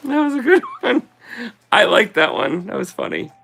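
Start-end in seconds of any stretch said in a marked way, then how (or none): 0.0-1.1 high, giggly after a big laugh; laugh-soaked high comment. Real, not acted. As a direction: still cracking up as you say it. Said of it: "I'm laughing so hard".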